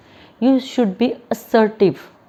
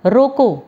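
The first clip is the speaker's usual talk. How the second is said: "रोको" punched higher and louder, then a break; emphatic "रोको" falling into a pause; pause after रोको; 4 semitones higher, 6 dB louder, slower than usual. Shown as a demonstration. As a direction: hit "रोको" hard and stop as at a comma. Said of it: "pause very करा".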